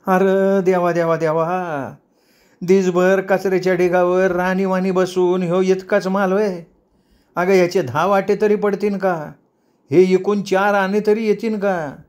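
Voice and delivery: high-pitched, wailing scolding; fuming old woman. A narrator's reading. Old woman scolds the girl over meagre pickings, fuming. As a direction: lament to God, then nag in a high voice. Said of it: "आतनं म्हातारी अंमलीवर जळफळली".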